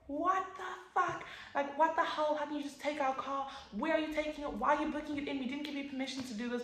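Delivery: high, outraged demanding of the police; furious mother and aunt. Retold or imitated. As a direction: throw the questions at them in fury. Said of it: "they had lost all sense of composure".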